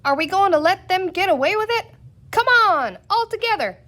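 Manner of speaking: high, loud and fast egging on; rallying cry. A narrator's reading. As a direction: whip the crowd into a charge. Rallying the mob.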